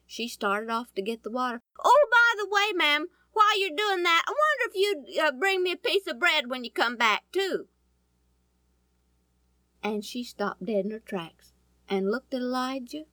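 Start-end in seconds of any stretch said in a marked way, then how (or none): 1.8-7.7 higher and louder, an overly polite extra request; cheeky afterthought. Acted. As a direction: slip in the bold favour brightly. Elijah adds a cheeky request.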